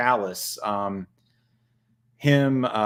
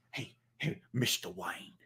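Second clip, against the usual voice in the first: softly voiced attendant addressing his master; hushed, coaxing servant voice; mock butler voice; 5 semitones lower, 13 dB quieter than usual. Acting Joker-juice skit with Batman.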